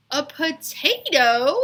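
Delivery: high, loud, drawn-out disbelief; shocked exclaim. Acted. Leslie shocked at the potato tattoo, high and slow. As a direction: cry out in disgust. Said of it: "exclaimed Leslie".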